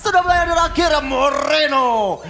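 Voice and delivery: loud, high hype play-by-play, peaking in a shout; esports caster. Acted. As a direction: build to a shout on the big play. Caster hype during Lord fight.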